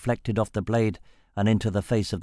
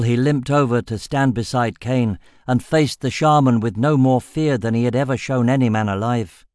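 plain reading voice

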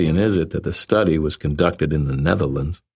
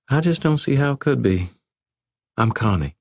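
put-on higher, playful young woman; 9 semitones higher than usual. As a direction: tease him like a Southern belle.

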